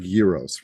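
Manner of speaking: "gyros" said as Greek "YEE-ros"; Greek pronunciation. Shown as a demonstration. Teacher comparing gamma to a food, "gyros" as "YEE-ros". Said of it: "Like, people say gyros".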